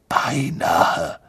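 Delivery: trauriges, tonloses Hauchen. Acted. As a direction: leise und wehmütig hingehaucht. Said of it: "sagte der Geist traurig". (traurig geflüstert)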